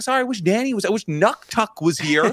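host apologising to the fan; mock apology. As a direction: apologise lightly. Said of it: "I was like".

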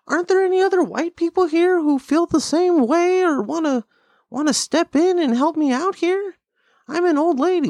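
high, pleading mock old lady. Imitating an old lady in a video, high pleading voice. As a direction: whine for help as an old lady. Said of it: "the lady was like".

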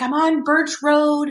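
high, drawn-out mock distress; imitated panic. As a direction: stretch it out like a retold frantic cry. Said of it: "he sounded hysterical".

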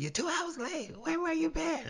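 very high, soft mock squeal; fussing girlfriend. Girlfriend scolding the late pair, high mock fussing. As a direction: imitate her fussing in a tiny, high-pitched voice. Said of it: "she was fussing".

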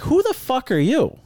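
high, slow, incredulous retort; indignant challenge. Retold or imitated. Racer retelling his challenge to a stranger, indignant challenge. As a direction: act out an affronted challenge, voice way up.

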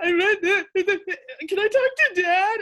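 high, loud, slow, laughing through tears; teary excitement. Retold or imitated. Daughter on the phone asking mom for dad, laughing through tears. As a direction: gush through tears of joy.

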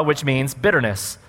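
ordinary delivery

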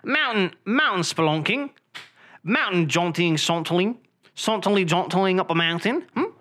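mock British accent, trying out words; hammed-up British accent. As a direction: savour each word in fake British. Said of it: "what would they call that in Britain".

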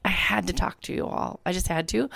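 soft, worn-out morning voice; tired voice. Real, not acted. Host explains her early start to listeners, tired and soft. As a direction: speak softly through tiredness. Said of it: "can you tell I'm a little like that voice".

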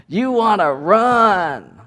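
high, long drawn-out exclamation; playful excitement. Real, not acted. Teasing children eager to run off.

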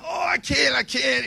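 high, loud whining protest; whiny siblings. Retold or imitated. Siblings refusing medicine, high whiny complaint. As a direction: whine like a kid who won't swallow. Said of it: "They're like".

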